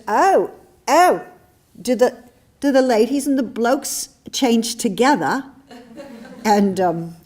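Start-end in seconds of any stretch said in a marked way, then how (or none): 0.1-5.4 a hammed-up British woman's voice, high and loud; bad British accent. Young woman in the locker room puzzled, in a bad British accent. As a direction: put on a bad British accent, chirpy and surprised. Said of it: "my British accent is really bad".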